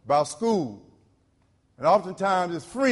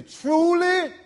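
"Truly" lanzado mucho más alto y fuerte; emphatic truly; 12 semitones higher, 6 dB louder than usual. Shown as a demonstration. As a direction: clava "Truly" muy arriba. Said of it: "I wanna put some emphasis on that".

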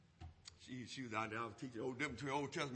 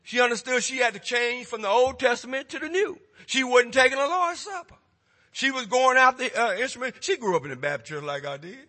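loud, driving pulpit preaching; preaching at full force; 6 semitones higher, 17 dB louder than usual. Acted. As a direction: hammer it home to the congregation.

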